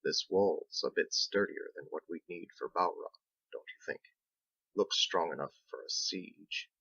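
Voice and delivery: low, soft confidential aside. Acted. Captain confiding his suspicion to Biggs, soft and low. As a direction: keep it between the two of you. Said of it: "Captain lowered his voice".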